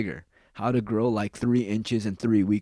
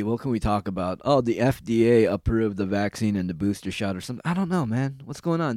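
ordinary talk

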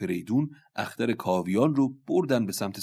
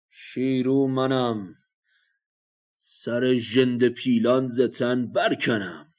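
slow, loud, grand warrior's boast; boastful declamation; 4 semitones higher, 7 dB louder, slower than usual. Acted. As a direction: proclaim yourself, drawing out every word. Warrior boasts before combat.

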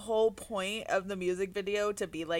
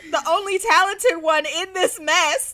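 indignant laughing rant; 5 semitones higher, 6 dB louder than usual. Retold or imitated. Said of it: "I was like".

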